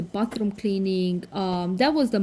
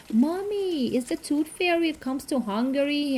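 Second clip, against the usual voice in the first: put-on child's question; 7 semitones higher than usual. Son asking about tooth fairy.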